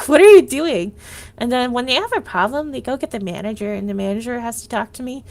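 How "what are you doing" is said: louder, laughing exasperated retort; incredulous loud what are you doing; mock loud retort. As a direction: throw it back at them with a laugh.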